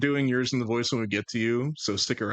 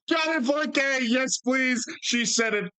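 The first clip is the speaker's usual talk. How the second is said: gleeful triumph; 13 semitones higher, slower than usual. Real, not acted. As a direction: crow in delight at catching someone out. Gleefully catches Faye saying yes.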